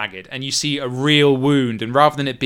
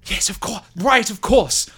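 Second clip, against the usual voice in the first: high, eager agreement; flustered Milos; 8 semitones higher than usual. Acted. Milos told there's no time, high flustered agreement. Milos answers the apology in character.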